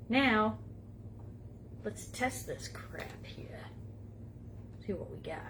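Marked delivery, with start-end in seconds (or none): 1.8-5.4 whispering. Real, not acted. Testing mic, unvoiced whisper.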